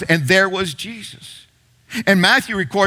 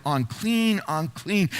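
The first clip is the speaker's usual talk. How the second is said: soft, lowered sorrowful calling-out; mock call; 2 semitones lower, 7 dB quieter, slower than usual. Leper warning people away, softly calling out. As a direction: say it as a hushed, shamed cry. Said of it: "gesture of calling out".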